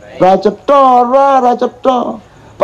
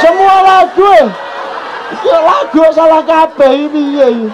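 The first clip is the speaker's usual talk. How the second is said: put-on child's angry protest; 10 semitones higher than usual. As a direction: shout it like an outraged kid. Father voicing the angry child.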